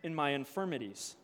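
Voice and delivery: measured, solemn Bible reading; scripture reading. Acted. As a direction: read the passage like a lesson in church. Reads Scripture aloud.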